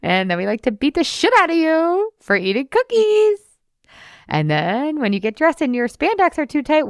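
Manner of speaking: high, sing-song mocking inner critic; a high, taunting inner voice singing out cravings and threats; taunting diet brain voice. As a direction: tease her like a sneering voice in her head. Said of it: "She's gonna be like".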